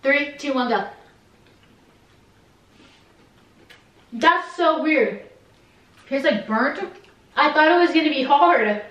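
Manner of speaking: speaking around chocolate; talking while chewing. Real, not acted. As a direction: mouth partly full of candy. Seen 0: Puts candy in mouth at -4s, talks while holding it after.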